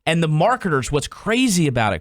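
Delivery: ordinary talk, nothing marked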